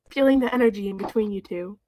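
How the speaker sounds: ordinary talk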